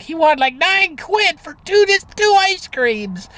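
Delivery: put-on high, slow little girl with a British accent; little English girl. To her mother about the ice cream man, high little girl voice. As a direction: mimic a small English girl's complaint. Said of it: "she was like".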